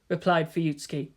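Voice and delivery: ordinary delivery, nothing marked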